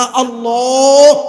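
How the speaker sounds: slow, stretched Allah; drawn-out call. Shown as a demonstration. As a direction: draw the name out long and loud. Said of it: "বলেন না".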